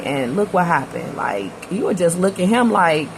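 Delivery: usual speaking voice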